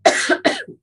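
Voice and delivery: sharp cough, still choking. Real, not acted. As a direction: cough it out hard. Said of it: "fill in while I choke".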